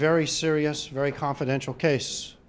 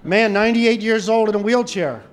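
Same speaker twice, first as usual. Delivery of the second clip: ordinary talk, nothing marked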